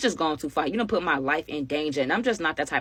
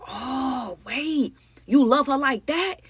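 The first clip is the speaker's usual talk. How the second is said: higher, drawn-out incredulous taunt; mock disbelief; 7 semitones higher, much slower than usual. Man to girl shielding her friend, mock disbelief. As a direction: act out his amazed discovery.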